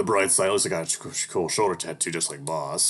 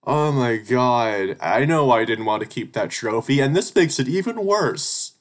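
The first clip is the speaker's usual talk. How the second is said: exasperated shouting; 7 semitones higher, 9 dB louder, slower than usual. Real, not acted. Still fuming at the game.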